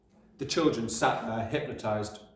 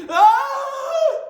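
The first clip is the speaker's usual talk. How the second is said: very high, loud yelp; child yelp; 12 dB louder than usual. A narrator's reading. Boy lifted by his hair, yelping. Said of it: "Rupert yelled".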